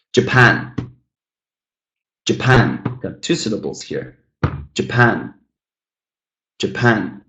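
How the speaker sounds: "Japan" stressed on PAN; light Ja, firm PAN in "Japan"; stress on second syllable. Shown as a demonstration. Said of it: "the stressed syllable is the second one".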